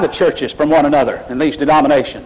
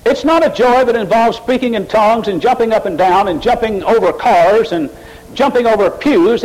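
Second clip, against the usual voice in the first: usual speaking voice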